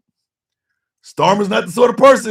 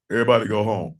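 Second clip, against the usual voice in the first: mocking Mike Johnson; 7 semitones lower, 5 dB quieter, much faster than usual. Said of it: "Not Mike".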